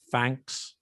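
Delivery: "thanks" with an F in place of the TH. Shown as a demonstration. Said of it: "the th sound can be a th".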